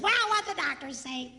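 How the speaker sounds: lightly voiced wife, just for effect; mock wife voice. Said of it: "She doesn't sound like that, by the way".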